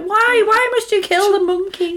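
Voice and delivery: high, wailing mock plea; mock anguish. Co-host recalls seeing the dead monkey paw, mock-anguished plea. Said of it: "and I was like".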